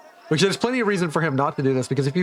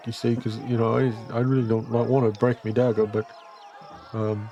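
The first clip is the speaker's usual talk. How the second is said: nervous dwarf. Acted. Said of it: "He's like".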